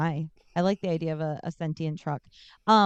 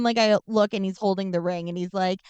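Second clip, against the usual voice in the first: ordinary talk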